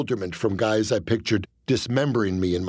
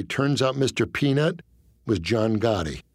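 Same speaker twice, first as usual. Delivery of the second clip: plain reading voice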